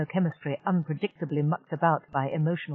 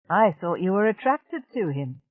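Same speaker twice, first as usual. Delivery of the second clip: usual speaking voice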